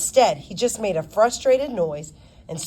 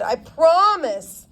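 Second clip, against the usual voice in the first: higher, louder, slow pleading "I promise"; earnest promise; 8 semitones higher, 5 dB louder, slower than usual. Acted. Girl pleading with her lawyer, slow and earnest. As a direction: drag out the promise, begging.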